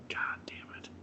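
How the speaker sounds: a rough whispered curse under the breath. Retold or imitated. Cellmate waking up and cursing, whispered. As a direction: mutter it in a hushed unvoiced whisper.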